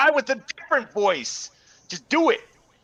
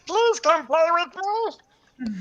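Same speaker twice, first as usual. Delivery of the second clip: put-on creepy child voice; 7 semitones higher, slower than usual. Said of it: "make my voice sound this way".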